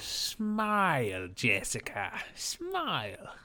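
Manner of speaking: imitating David Tennant's Kilgrave, softly; a soft creepy command to smile; a touch of a menacing villain. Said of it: "Jessica Jones saying she won't smile to David Tennant".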